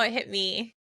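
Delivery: ordinary talk, nothing marked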